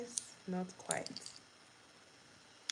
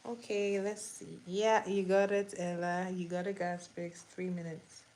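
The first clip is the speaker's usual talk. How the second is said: ordinary talk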